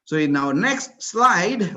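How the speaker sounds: normal talking voice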